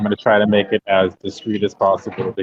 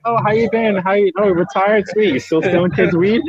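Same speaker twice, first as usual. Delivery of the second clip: mock friendly greeting; 10 semitones higher than usual. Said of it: "I'm like".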